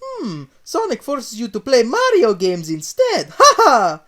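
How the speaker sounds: high, loud Italian plumber pun; Mario makes a pun, loud Italian laugh; Mario joking. Acted. Mario voiced in story.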